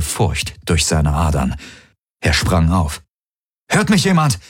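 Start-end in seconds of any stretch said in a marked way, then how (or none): 3.7-4.4 calling out. Acted. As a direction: shout anxiously for an answer. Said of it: "rief er in sein Comm". (aufgeregter Ruf)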